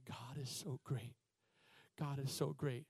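quiet, slow, breathy-free murmur of praise; soft imitation of praise. Pastor echoing a hospital patient's praise, softly. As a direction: echo a suffering man's soft praise. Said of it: "How he was saying".

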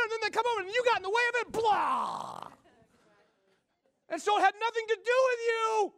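high, loud, fast fervent preaching; rising to a preacher's shout. Real, not acted. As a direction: drive it home at full force. Preaching builds to full force.

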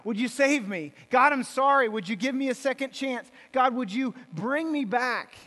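loud, pleading cry to God, slowed; desperate plea. Retold or imitated. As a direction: beg God for life as she slips away. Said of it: "she, she says".

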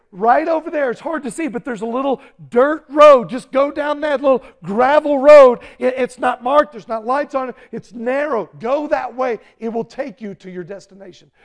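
directing a driver by the roadside, loud; urgent directions. Retold or imitated. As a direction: point them to the safe road. Guiding driver, shouting.

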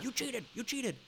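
high mocking whine; shrill mock accusation; mock yelling. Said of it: "she yells at the ref".

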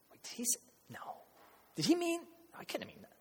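hushed, halting disbelief; very soft, broken-off questions; stunned murmur. Retold or imitated. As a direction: imitate confused onlookers whispering. Stunned hearers whispering.

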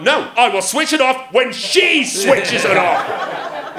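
high, loud, slow taunting; laughing mock defiance; defiant taunt. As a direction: defiantly dig your heels in, laughing. Retold defiant reply.

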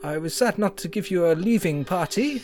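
put-on halting, French-accented politician. Chancellor updating old friend on the country, French accent. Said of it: "I'm just gonna stick with it".

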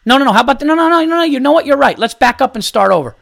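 high, loud, hammed-up panic of compliance; mock frantic correction. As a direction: play at frantically starting over for him. Acted mock compliance to annoyed dad.

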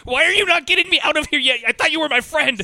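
loud, high panicked protest; panicked demand. Acted. Trapped guy to the friend leaving him, panicked demand. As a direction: frantically berate a friend for not helping. Acting someone trapped, begging rescue.

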